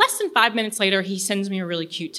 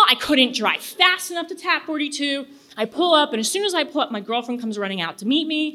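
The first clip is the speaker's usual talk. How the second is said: ordinary delivery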